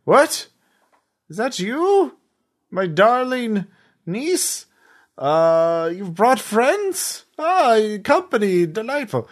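put-on high-pitched, delighted old-uncle character; uncle over a speaker. Uncle greets his niece over a speaker, high delighted voice. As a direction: greet visitors in a high, drawn-out voice. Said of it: "a speaker crackles on".

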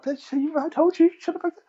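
mock bitchy fight voice. Play fight mock insult.

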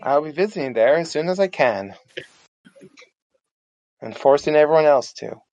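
ordinary talk

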